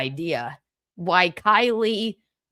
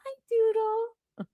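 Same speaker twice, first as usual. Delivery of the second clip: high, soft, slow coo; 10 semitones higher, 7 dB quieter than usual. Real, not acted. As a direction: coo it like talking to a puppy. Cooing at a pet.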